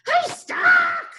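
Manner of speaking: very high, loud squeaky yell; a trapped goblin yelling in panic; goblin shout. Acted. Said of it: "the goblin shouted".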